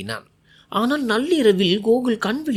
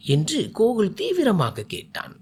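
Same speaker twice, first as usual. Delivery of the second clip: plain reading voice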